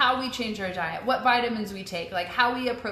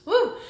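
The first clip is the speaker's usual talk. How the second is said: cheer; 13 semitones higher, 8 dB louder than usual. Real, not acted. Cheer after a pause.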